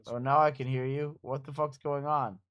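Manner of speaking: usual speaking voice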